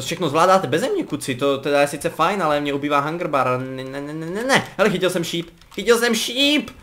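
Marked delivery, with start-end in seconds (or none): none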